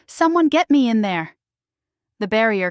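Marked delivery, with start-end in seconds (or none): 0.1-1.4 higher, louder angry snarl. Acted. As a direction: snarl it from behind the barrier. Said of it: "Rhett snarls".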